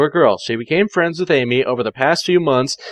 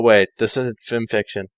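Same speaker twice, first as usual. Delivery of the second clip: plain reading voice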